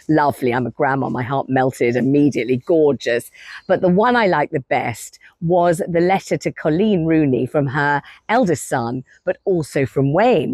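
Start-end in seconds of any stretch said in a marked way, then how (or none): none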